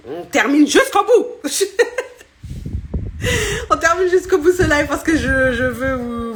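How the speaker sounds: giggling while promising to finish; laughing. Real, not acted. To her live viewers after the stream cut, laughing.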